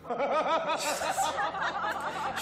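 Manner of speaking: high, slow, laughing dream; sighing with happy longing; dreamy delight. Real, not acted. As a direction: swoon over the wish. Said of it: "dreamy wish, slowed".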